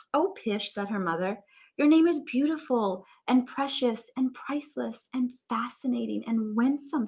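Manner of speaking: read-aloud storybook voice; story narration. A narrator's reading. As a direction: read on to the class. Teacher reads book aloud.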